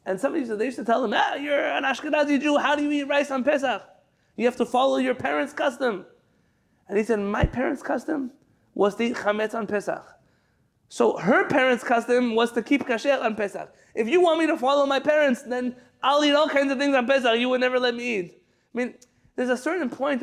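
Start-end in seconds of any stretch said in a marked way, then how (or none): 1.1-6.1 higher, incredulous challenge; challenging critic. Retold or imitated. People challenging the congregant. 7.2-18.4 retort. Retold or imitated. Congregant firing back at his critics, cheeky.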